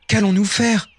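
high, anxious question; worried villagers. Acted. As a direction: fret aloud, pitched well up. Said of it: "s'inquiètent les villageois".

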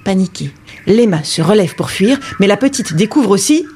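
plain reading voice

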